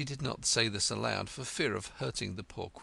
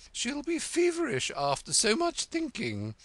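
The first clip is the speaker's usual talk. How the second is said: anxious; 6 dB louder than usual. A narrator's reading. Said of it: "the Red Queen anxiously interrupted".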